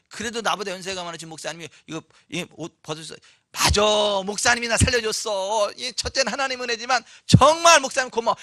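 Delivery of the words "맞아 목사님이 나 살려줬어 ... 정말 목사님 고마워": louder, heartfelt thanks; grateful reply. Pretend. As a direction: thank him sincerely and gladly. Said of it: "이렇게 해야 되는 거 아니에요". (진심으로 고마워하는 목소리)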